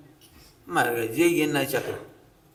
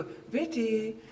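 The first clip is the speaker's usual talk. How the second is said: put-on higher, honeyed call to a guest's child; sugary coaxing host; 6 semitones higher, 6 dB quieter, much slower than usual. Host sweetly coaxing the guest's unruly child to eat. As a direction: coax the spoilt kid sweetly. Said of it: "ऐसे".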